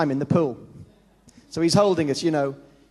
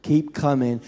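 soft, slow, low urging; God softly urging the swimmer on; gentle coaxing; 7 semitones lower, 3 dB quieter than usual. Retold or imitated. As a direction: draw them forward gently. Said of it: "He's going".

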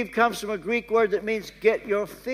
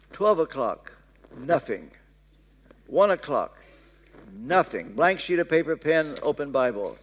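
usual speaking voice

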